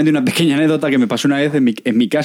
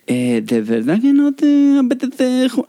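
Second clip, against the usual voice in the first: higher, slow coaxing; pleading host; 10 semitones higher, slower than usual. Retold or imitated. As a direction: coax the guest politely. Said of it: "es el anfitrión".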